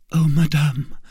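fervent high outcry; earnest cry. A narrator's reading. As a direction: call out imploringly. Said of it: "cried the young woman".

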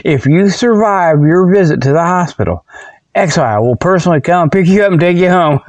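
ordinary talk, nothing marked

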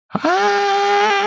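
piercing high scream. A narrator's reading. Chiara screams at hearing of the search, piercing. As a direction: scream like you're being stabbed. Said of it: "закричала, как резаная".

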